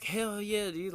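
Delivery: high, eager retold yes to a buddy; excited. Friend offered him pills, retold excitement. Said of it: "I was so fucking excited".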